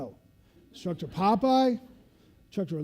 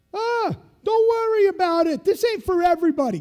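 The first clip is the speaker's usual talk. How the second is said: high, loud, fast fake kindness; retold instructor's mock comfort; mock consoling; 13 semitones higher, 12 dB louder than usual. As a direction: pat him off with false cheer.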